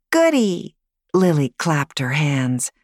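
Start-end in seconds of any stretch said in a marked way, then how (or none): 0.1-0.8 put-on high, loud child cheer; delighted little girl. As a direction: squeal like a happy six-year-old. Girl cheers about kissing.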